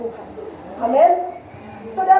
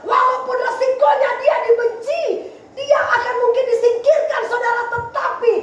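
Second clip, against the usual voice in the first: preaching at full force; 7 semitones higher than usual. Real, not acted. Preacher telling the congregation of Hizkia's costly stand, full-force preaching. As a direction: drive each phrase hard at the congregation.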